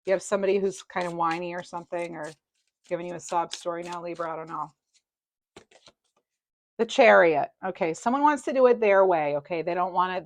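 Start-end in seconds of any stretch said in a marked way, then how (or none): none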